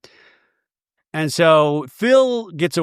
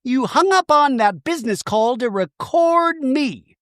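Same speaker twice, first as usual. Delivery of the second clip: higher, slow sarcastic scolding; mock sarcastic Shannon; 7 semitones higher, slower than usual. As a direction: needle her sarcastically. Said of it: "Shannon goes".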